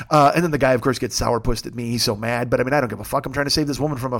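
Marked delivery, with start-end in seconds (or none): none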